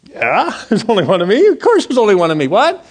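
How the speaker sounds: mock-baffled reply, rising to a high "what"; baffled incredulity. Driver answering the officer's odd remark, incredulous. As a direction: question the obvious in disbelief.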